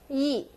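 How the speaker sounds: "yi" short and sharply falling; sharp fourth tone. Shown as a demonstration. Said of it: "And the fourth one".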